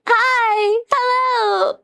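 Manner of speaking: high called-out hello; excited greeting. Real, not acted. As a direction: shout a cheerful hi. Calling out to chat.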